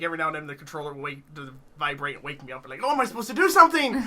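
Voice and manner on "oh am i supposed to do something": startled waking. Retold or imitated.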